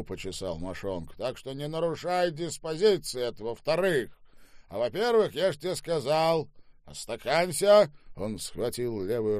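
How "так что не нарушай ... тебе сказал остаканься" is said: loud, high-pitched theatrical declaiming; coarse, bullying Штаубе. Acted. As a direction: hold forth like a boorish drunk master. (грубый, напористый Штаубе, с декламацией)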